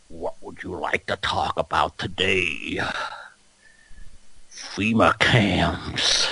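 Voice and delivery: very slow, low mock-seductive growl; Alex Jones. Conspiracy host on a sex hotline, slow hammed-up seduction. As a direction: draw every word out low, like a sultry parody. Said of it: "the Alex Jones hot sex hotline".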